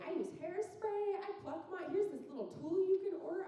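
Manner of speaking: put-on chatty older women. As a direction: gush like a bachelorette guest sharing beauty tips. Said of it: "They were like".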